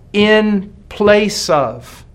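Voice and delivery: "in place of" drawn out slowly, each word weighted; stress on in place of. Shown as a demonstration. Preacher to congregation, stressing substitution: slow weighted "in place of". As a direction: lean hard on "in place of", slowly. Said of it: "I want to put special emphasis on the, on the word anti".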